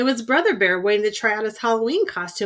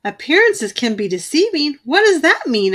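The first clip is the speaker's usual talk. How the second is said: puzzled question; 5 semitones higher, 6 dB louder than usual. A narrator's reading. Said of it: "asked Sister".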